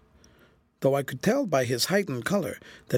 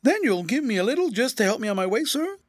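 high, whining plea; cringing; 8 semitones higher than usual. A narrator's reading. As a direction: beg servilely, rising at the end. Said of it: "said the cringing mendicant".

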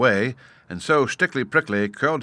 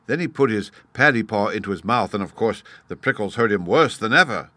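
usual speaking voice

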